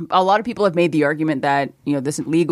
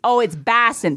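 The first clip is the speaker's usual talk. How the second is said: higher mock Chicago accent; 4 semitones higher than usual. Imitating everyone's wrong Boston, in a Chicago accent. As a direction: flatten the vowel of "Boston", Chicago style. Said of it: "That's a Chicago accent".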